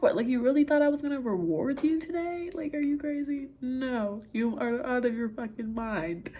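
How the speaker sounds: high-pitched, incredulous telling-off; reassure, then turn it into a mock scolding; retold sassy scolding. Retold scolding of crying friend.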